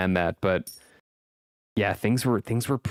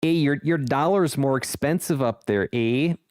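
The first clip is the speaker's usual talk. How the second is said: mock Canadian accent; 5 semitones higher than usual. Continues Canadian teasing.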